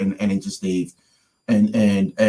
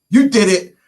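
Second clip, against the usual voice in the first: high, loud retold accusation; emphatic, raised-voice blame; insistent accusation; 11 semitones higher, 8 dB louder than usual. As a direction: pin the prank on him. Insists friend did the prank.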